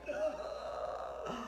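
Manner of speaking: soft, breathy swooning gasp; awestruck gasp. Retold or imitated. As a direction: gasp softly at something adorable. Swooning at the pretty ears.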